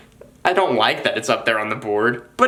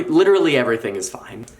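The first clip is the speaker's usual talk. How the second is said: ordinary talk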